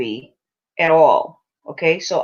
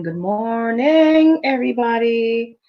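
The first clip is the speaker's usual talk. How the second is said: slow drawn greeting; 3 semitones higher, slower than usual. Shown as a demonstration. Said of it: "stretched greeting to viewers".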